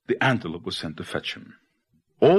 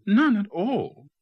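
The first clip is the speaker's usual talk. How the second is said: high, loud storybook protest; high exclaimed denial; crying out; 13 semitones higher, 4 dB louder, slower than usual. A narrator's reading. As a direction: cry out the iguana's denial, pitch leaping up. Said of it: "cried the iguana".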